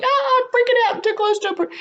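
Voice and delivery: high-pitched freak-out as a skittish kitten; panicked feral kitten. Acted. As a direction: squeal it like a cornered little animal. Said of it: "have you ever seen a feral kitten".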